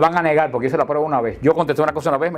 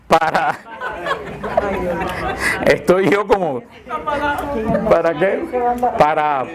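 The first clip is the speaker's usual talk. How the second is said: laughing; 4 semitones higher, slower than usual. Real, not acted. Se ríe de su desliz.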